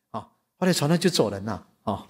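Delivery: ordinary talk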